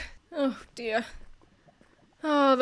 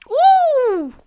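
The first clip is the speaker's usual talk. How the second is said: excited; 9 semitones higher, 8 dB louder than usual. Real, not acted. Excited find.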